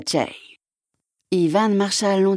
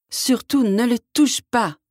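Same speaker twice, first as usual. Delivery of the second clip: higher, insistent urgency; pressing warning; 5 semitones higher than usual. A narrator's reading. Pale man insists prince leave egg alone, urgent. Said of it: "d'une voix pressante".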